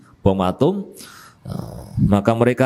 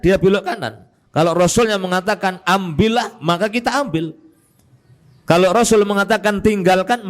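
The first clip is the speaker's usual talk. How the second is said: fervent sermon delivery, raised pitch; preaching; 7 semitones higher than usual. Acted. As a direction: preach it with rising conviction. Preaching with rising force.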